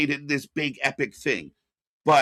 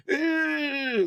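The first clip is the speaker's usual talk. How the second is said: wheezy imitation; 12 semitones higher than usual. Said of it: "he has emphysema".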